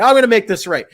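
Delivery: loud, high vow to fix it; indignant owner. Retold or imitated. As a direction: imitate an outraged owner taking charge. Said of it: "He couldn't believe I got shushed his establishment".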